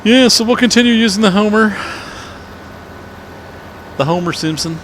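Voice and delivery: high, put-on cartoon voice; Homer Simpson. Streamer clowning about using the Homer, hammed-up cartoon voice.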